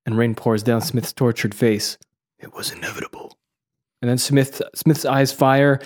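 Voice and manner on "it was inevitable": very low, breathy near-whisper; hushed resignation. Acted. Neo accepts his end before Smith, hushed. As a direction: let it out under your breath. Acting Neo's last line to Smith.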